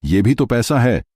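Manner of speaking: high-pitched indignant protest; angry outcry. A narrator's reading. Payal confronting Arjun over wasted money, angry and high-pitched. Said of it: "उसने गुस्से से चिल्लाते हुए".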